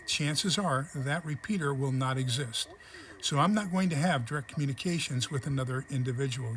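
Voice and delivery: ordinary talk, nothing marked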